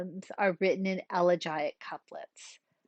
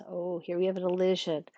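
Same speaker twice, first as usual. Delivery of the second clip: normal talking voice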